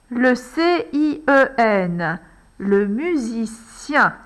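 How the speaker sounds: letters said slowly one by one, then "Le musicien" drawn out; slow spelling. Shown as a demonstration. Teacher writing on the board, slow dictation. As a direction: dictate each letter slowly, as the pen moves. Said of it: "pour aller écrire".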